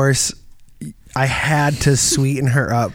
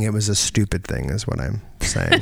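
ordinary delivery

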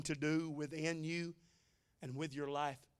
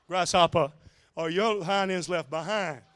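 a hint of a Kung Fu master, louder, with a laugh; goofy Kung Fu master; 9 dB louder than usual. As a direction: tease the listener as a wise old master, lightly. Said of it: "my goofy one-liner".

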